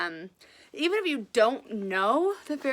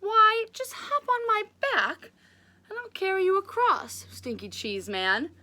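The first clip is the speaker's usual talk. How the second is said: sly fox voice; 9 semitones higher, 3 dB louder than usual. Acted.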